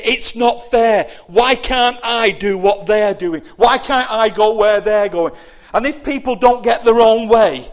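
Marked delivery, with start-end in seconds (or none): 0.0-5.3 sad whine. Acted. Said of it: "and they say".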